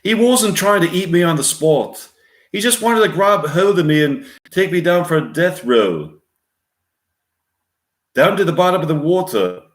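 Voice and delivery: attempted Australian accent, pitched high; serious storytelling in a put-on Australian accent; Australian impression that slips toward English; Paul Hogan. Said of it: "with Australian accent, speaking seriously and quietly".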